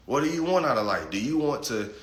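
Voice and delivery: ordinary delivery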